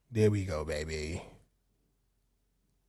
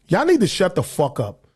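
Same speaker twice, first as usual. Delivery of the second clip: usual speaking voice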